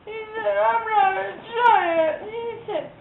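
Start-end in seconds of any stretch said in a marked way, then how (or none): 0.6-2.2 put-on high, slow crying boy.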